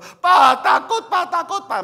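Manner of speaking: high, loud mock cry of a frightened child; scared child pleading. Child begging his father not to leave the pool, high and frightened. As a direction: plead like a terrified kid clinging to dad. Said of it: "Anak saya itu pegangan saya dua-dua".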